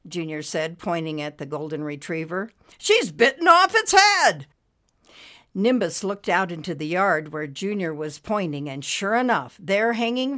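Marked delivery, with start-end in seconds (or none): 2.8-4.5 loud, high-pitched exclamation; outraged cry. A narrator's reading. Junior horrified at the dog.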